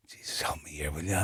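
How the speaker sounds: very low, barely audible plea; hushed, low aside to God; muttered prayer. Retold or imitated. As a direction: mutter it privately under your breath. Voicing a silent plea in chapel.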